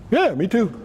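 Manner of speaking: high-pitched, lightly acted agreement; apostles chiming in. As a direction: pipe up as a tag-along friend. Voices apostles agreeing with Peter.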